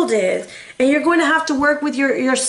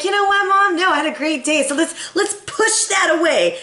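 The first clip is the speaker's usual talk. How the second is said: lightly voiced little girl, higher pitch; imitating daughter; 6 semitones higher than usual. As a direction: play a daughter brushing Mom off, pitched up. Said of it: "She was like".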